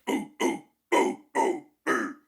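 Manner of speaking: imitating a singer's gorilla-like ooh grunts; airy chesty gorilla hoots. Coach imitating the singer's gorilla sounds, airy hoots. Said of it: "like this, like, gorilla".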